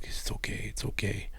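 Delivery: very soft, half-whispered comfort; soothing dad. Retold or imitated. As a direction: hush someone tenderly, imitating a reassuring father. Dad soothes, playing role of God.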